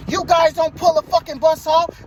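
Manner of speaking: retold furious shout at the driver; angry yell. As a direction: bark it like an irate passenger.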